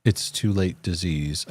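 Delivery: ordinary talk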